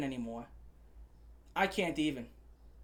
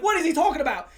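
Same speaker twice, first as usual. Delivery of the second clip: high, loud disbelief with a laugh; baffled, half laughing at the claim; incredulous; 8 semitones higher, 7 dB louder, faster than usual. Retold or imitated. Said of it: "I was like".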